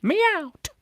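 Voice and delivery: very high, squeaky mewing call; a cat trying to talk; cat-like squeak. Pretend. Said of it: "like a cat with a fricative stop".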